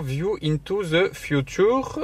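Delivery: put-on slow, careful English accent on the slogan. Reading the box slogan to viewers in an English accent. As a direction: read it out like a native English speaker. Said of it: "mon accent anglais".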